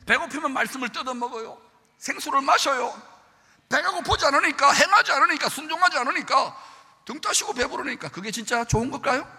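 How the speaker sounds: loud, high impassioned preaching; fiery preaching. Acted. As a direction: build it to a passionate climax. Preacher at full force.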